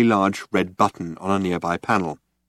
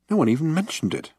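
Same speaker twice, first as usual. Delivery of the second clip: irritable mutter; 7 semitones higher, faster than usual. A narrator's reading. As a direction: grumble it, annoyed. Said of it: "muttered Arthur irritably".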